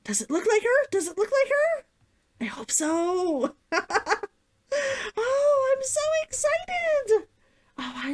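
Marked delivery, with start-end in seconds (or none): 0.3-1.8 high hopeful ask; eager high question. Real, not acted. Asking viewers if she resembles the character, eager. 5.2-7.3 very high, slow squeal; excited squeal. Real, not acted. Telling viewers how excited she is, high squeal.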